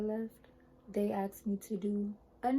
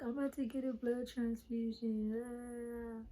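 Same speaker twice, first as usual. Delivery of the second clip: slow, higher anxious inner voice; worried thought; 4 semitones higher, slower than usual. Retold or imitated. She recalls fearing a transfusion, slow and worried. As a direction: replay the dread of the thought. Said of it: "I kept thinking".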